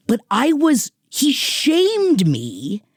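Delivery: usual speaking voice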